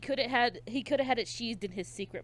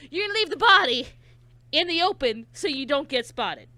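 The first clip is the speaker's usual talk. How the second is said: sarcastic disbelief; 8 semitones higher, 9 dB louder than usual. Real, not acted. Mocking the killer's logic.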